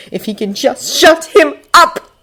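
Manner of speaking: frantic, climbing to a high scream; mounting desperation. A narrator's reading. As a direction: build from urgency to a desperate screamed name. Desperate thoughts build to scream.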